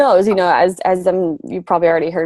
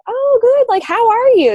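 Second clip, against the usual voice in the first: much higher, happily surprised reply; imitating a cheerful friend, pitched up; pleased reply; 4 dB louder than usual. Everybody answering her check-in message, bright and pleased.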